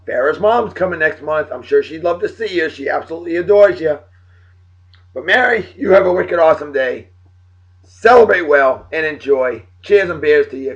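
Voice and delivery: ordinary delivery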